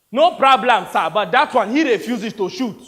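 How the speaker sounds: put-on loud, high African-accented soldier; exaggerated African accent. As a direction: belt it out in a thick African accent. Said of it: "Read the lines like Toby".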